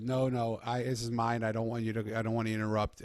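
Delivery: loud, firm no, keep me out of it; emphatic refusal. Retold or imitated. Homeless man turning the visitor away, loud and firm. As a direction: shut the visitor down firmly.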